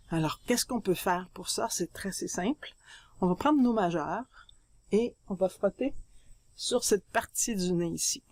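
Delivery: usual speaking voice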